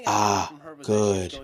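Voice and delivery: low, soft, satisfied "good" after a drink; imitating Ryo. Ryo savoring a drink, imitated low and soft. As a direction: sigh it out low like a stoic hero sipping. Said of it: "he goes".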